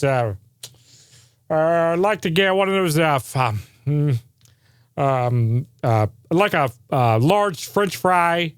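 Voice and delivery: hesitant, dithering order full of ums; a lightly voiced dithering customer; fumbling customer. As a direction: play a clueless customer stalling at the speaker box. Mocking a bumbling drive-thru customer.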